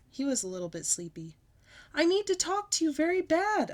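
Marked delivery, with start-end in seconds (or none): 1.9-3.7 high, loud urgent shout; loud pleading shout. A narrator's reading. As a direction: shout it at Mother, much higher. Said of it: "I shouted".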